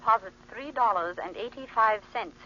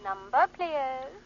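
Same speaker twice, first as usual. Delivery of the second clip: slow, thin telephone-line voice; operator over phone; slower than usual. Acted. Operator answering the stranded driver's call, phone-filtered. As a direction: sound like a switchboard operator down the wire.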